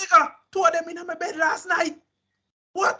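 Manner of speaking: high mocking brag; boastful comeback. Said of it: "I said".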